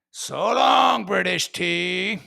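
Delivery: drawn-out, jeering goodbye called out loud; mock farewell shout. Bostonians seeing off British tea, mock farewell shout. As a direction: holler it off slowly, good riddance.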